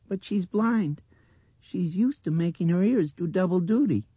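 very high, loud child's mimicry; mimicking little girl. A narrator's reading. Blind girl repeating the men's mutters, high mimicry. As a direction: echo it loudly as a girl. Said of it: "she mimicked".

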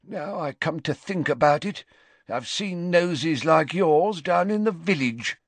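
muttered sneering insult; old man muttering a sneer at the official. A narrator's reading. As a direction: slide the insult out with contempt. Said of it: "an offensive tone".

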